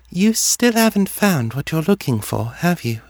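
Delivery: high dreamy seer voice; detached, as if talking through a dream; distant. A narrator's reading. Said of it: "in that same distant voice".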